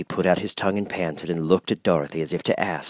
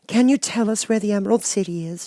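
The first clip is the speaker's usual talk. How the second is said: put-on young girl voice; 14 semitones higher than usual. A narrator's reading. Girl telling the mouse queen they're lost, high voice.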